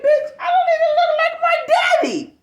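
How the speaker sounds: high, loud indignant yell; outraged shout. Retold or imitated. Telling off the coworker, high indignant shout. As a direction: shout the comeback in disbelief.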